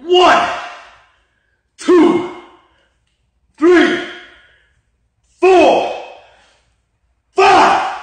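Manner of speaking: loud, high, strained rep count; counting workout reps. Real, not acted. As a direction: push each rep out with effort.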